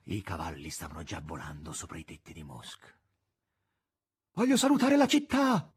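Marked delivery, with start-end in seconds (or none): 4.3-5.7 high, loud call; shouting. A narrator's reading. Maestro calling Azazello flying ahead, high shout. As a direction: call it out over a distance. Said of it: "gridò il maestro ad Asasello".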